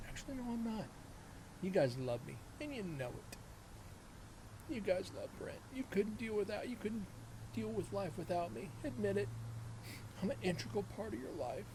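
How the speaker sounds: hammed-up arrogance, barely holding a straight face; mock cocky boasting. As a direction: tease the viewers like you're full of yourself. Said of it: "I can't even say that with a straight face".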